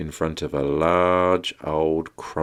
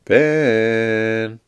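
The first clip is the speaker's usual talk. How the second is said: sing-out call of a name; drawn-out call; 5 semitones higher, 3 dB louder than usual. Pretend. Daddy calls Vin to bedtime story, drawn-out call. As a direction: draw the name out, higher.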